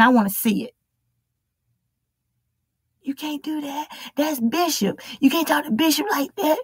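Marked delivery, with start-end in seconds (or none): 3.0-6.6 higher-pitched mock objection; scandalized church member. Follower objecting to talk about Bishop, scandalized. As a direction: protest in a put-on, higher voice.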